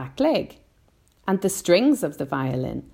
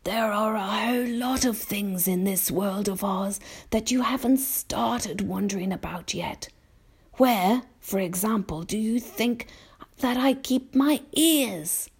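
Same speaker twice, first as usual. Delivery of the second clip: gentle; 7 semitones higher than usual. A narrator's reading. Old grasshopper quizzes young James, gently teasing. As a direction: muse kindly, lingering on the final question. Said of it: "said gently".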